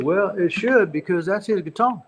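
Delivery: a hint of Billy Joe, a bit amused; Billy Joe's reply. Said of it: "And he said".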